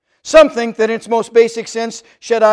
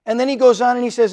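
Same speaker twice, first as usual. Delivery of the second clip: ordinary delivery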